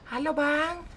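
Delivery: put-on high girly falsetto voice; sassy drag queen. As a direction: squeak it like a camp girl. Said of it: "pakai suara kecil tuh yang cewek".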